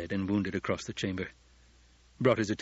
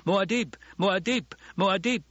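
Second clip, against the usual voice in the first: high-pitched rallying chant of a warrior; battle cry; 9 semitones higher than usual. A narrator's reading. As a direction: cry the name out three times, high and urgent. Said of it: "his battle cry was lifted to echo".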